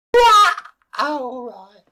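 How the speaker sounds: high shocked "What"; startled yelp. Acted. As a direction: jump at the offer. Queen startled then relieved.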